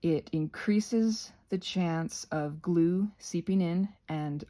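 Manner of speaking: normal talking voice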